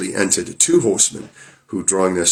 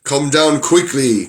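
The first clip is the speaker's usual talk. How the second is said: slow, louder demand; angry shout; 5 semitones higher, 4 dB louder, slower than usual. Acted. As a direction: bark it up the stairs. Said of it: "cried the Bluebeard".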